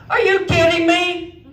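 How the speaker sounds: high, slow, drawn-out disbelief; exasperated disbelief. Retold or imitated. Stuck in the bathroom, wailing high in disbelief. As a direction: wail it out in stunned disbelief.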